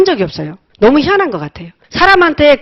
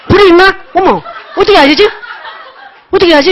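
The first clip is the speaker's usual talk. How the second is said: flustered panic; 7 semitones higher, faster than usual. Retold or imitated. New driver at night with no headlights, flustered laughing panic. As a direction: replay the moment you can't find the headlights. Can't find headlights while driving.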